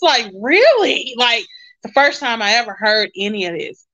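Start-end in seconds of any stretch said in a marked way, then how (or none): 0.4-1.1 high, loud disbelieving really; can't believe the good news; a sharp jump up in pitch and volume on the word; surprised. Retold or imitated. Hearing about aid for the first time.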